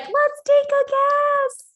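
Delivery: high, slow, bright coaxing; upbeat coaxing. Retold or imitated. Answering your own brain with a cheerful nudge. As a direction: coax yourself like a cheerful coach. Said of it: "be like".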